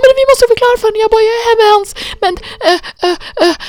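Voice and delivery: high, loud, desperate plea; frantic begging. Retold or imitated. Scared the stranger will hang up, frantic high plea. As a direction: plead frantically, voice sky high. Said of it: "så rädd att den skulle lägga på".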